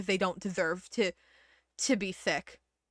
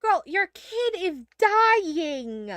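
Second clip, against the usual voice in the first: slow, higher, incredulous yell at the screen; exasperated outburst; 6 semitones higher, much slower than usual. Real, not acted. Streamer yelling at the game mother, exasperated. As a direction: call out the obvious in disbelief.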